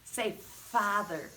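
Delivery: "father" said slowly with teeth on lip; modelling the F sound slowly; slow demo. Shown as a demonstration. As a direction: draw out the F in "father". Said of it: "Francie Fish says".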